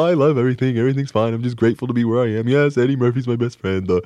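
hammed-up dim, cheery self-parody; big doofus. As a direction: gush like a big grateful doofus. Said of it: "this big doofus guy that walks around and goes".

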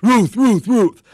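high, loud barks shaped as words; three woof-like shouts, high and punchy; dog barking a name. Acted. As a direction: bark the name like a dog woofing. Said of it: "And the dog goes".

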